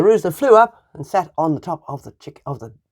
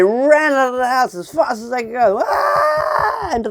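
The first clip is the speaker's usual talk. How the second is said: panicked shout; 8 semitones higher than usual. A narrator's reading. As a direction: yell as if running for your life. Robbers flee in terror.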